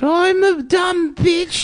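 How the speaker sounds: very high, drawn-out mock prime minister; screechy falsetto caricature of a British lady; Margaret Thatcher. Riffing on a Thatcher film, very high hammed-up caricature. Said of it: "it's Margaret Thatcher".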